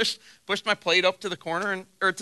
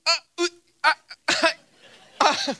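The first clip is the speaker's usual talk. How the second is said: flustered stammer; 8 semitones higher than usual. Retold or imitated. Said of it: "he was just like".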